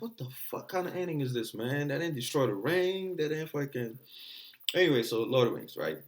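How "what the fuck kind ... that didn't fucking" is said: high indignant complaint; complain, pitched up, that the ending cheated you; mock outrage. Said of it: "I was like".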